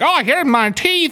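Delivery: high, slow mock voice of a delighted lady; imitating the toothless lady. Imitating the Hoarders lady finding her dentures, high and slow. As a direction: squeak it out like she found a treasure. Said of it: "she was like".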